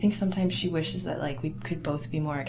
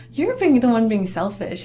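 indignant retort; 5 semitones higher, 9 dB louder than usual. Retold or imitated. Retorting to father's accusation.

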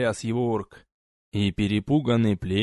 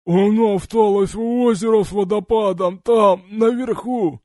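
gurgling with blood; 10 semitones higher, 6 dB louder than usual. A narrator's reading. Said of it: "пробулькал один из дезертиров".

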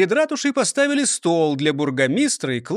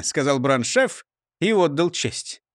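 ordinary delivery, nothing marked

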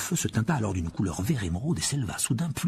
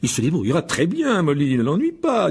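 curious, chuckling father; intrigued by Muggle ways; amused Mr Weasley; 5 semitones higher, 5 dB louder than usual. Acted. Mr Weasley voiced. (M. Weasley amusé)

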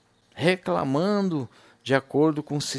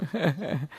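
laugh; 4 dB quieter than usual. Real, not acted. Laughs after the brother's last words.

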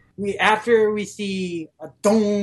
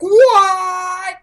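high, loud startled yelp; shocked what; 12 semitones higher, 8 dB louder than usual. Retold or imitated. Gintoki and Shinpachi yelping in shock at Kagura. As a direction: jolt out a shocked cry. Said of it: "Gintoki and Shinpachi are like".

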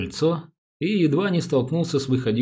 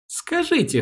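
high-pitched, ingratiating opener; insinuating. A narrator's reading. Policeman begins a delicate question to the wife, insinuating. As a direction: ease in coaxingly, voice lifted. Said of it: "вкратчиво начал Дрёмин".

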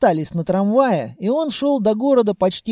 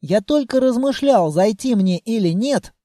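usual speaking voice

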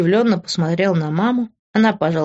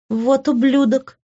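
slow, higher curse through gritted teeth; 7 semitones higher, slower than usual. A narrator's reading. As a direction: draw out the insult with contained anger. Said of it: "процедила Кори". (медленно, сквозь зубы)

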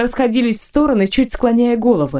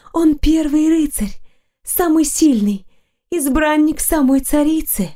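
put-on admiring girl; 7 semitones higher than usual. A narrator's reading.

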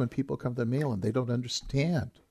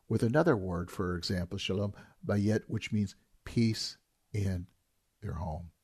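ordinary talk, nothing marked

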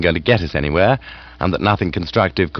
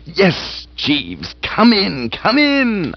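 higher, excited eager cries; eager cry; 9 semitones higher, much slower than usual. Acted. Bertie eagerly crying to Jeeves to enter. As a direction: call out eagerly. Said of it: "I cried".

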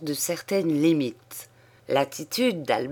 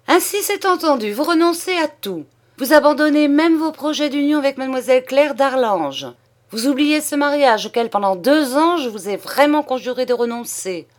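indignation railleuse; 8 semitones higher, 5 dB louder, faster than usual. Acted. Comte raille son fils, indigné.

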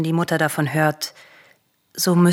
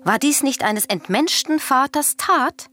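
indignant outcry; 8 semitones higher than usual. Acted. Queen justifies murder to Elektra.

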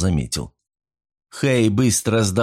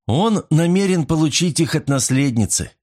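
ordinary delivery, nothing marked

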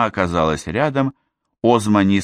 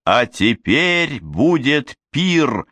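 higher, slow festive call; joyful announcement; 6 semitones higher, much slower than usual. Acted. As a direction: announce the feast with delight. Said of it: "Озма воскликнула".

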